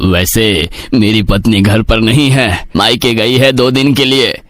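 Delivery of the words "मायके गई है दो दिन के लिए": ordinary talk, nothing marked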